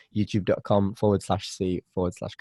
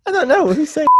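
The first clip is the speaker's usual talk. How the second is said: high squeaky voice; 3 dB louder, faster than usual. Pretend. Mock confusion.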